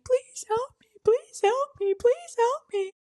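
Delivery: very high squealed plea; squeaky, hammed-up begging, the same each time; mock hysterical crying. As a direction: imitate sobbing pleas in falsetto. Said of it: "I'm, like, hysterically crying".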